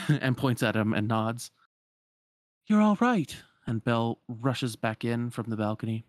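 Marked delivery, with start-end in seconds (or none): 2.7-3.3 high, loud relieved cry; relieved exclamation. Acted. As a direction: burst out in relief. Relieved greeting.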